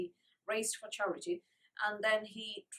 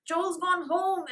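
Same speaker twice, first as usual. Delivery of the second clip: high, slow, loud; distressed announcement; 8 semitones higher, 7 dB louder, slower than usual. A narrator's reading. Francie telling Polly Joel has left. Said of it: "announced Francie".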